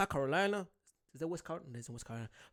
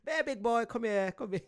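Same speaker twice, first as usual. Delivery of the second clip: higher, badly faked American accent; mock American neighbour; 6 semitones higher than usual. Neighbour calling to the delivery driver, mock American accent. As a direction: ham up an American local calling someone over. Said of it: "that's the way they make people".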